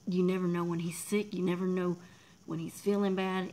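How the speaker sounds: ordinary delivery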